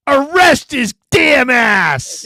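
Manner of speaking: high, loud, drawn-out proclamation; hammed-up angry verdict; mock furious shout. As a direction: bellow it slowly as the fed-up old man. Old man's dramatic retold verdict.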